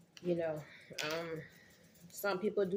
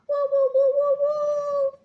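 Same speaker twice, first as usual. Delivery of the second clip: excited whooping; 13 dB louder than usual. Real, not acted. Hometown pride, whooping.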